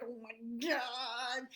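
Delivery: slow, strained mock dog voice; lightly voiced anxious dog; stressed little dog. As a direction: voice the worried puppy, drawn out. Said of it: "the poor little dog's like".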